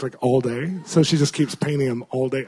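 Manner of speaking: ordinary talk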